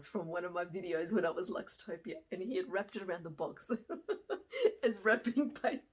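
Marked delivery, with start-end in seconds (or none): 3.8-4.8 bright high laugh bubbling out; burst of laughter. Real, not acted. Teacher recalling the student's prank, breaking into laughter. 4.8-5.8 words squeezed through laughter; giggling while telling it; laughing. Real, not acted. Teacher describing her face as wrapping paper, laughing.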